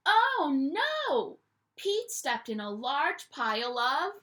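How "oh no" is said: ordinary delivery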